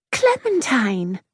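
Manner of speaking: high, drawn-out scandalised name; shocked schoolgirl reproach; protesting. A narrator's reading. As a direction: protest slowly on a high pitch. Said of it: "Octavia protested".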